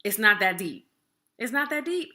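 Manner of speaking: high, loud mock brush-off; dismissive. Shown as a demonstration. Vlogger mocking a dismissive friend: high, loud, offhand. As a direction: toss it off as if it's nothing. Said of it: "that's dismissive".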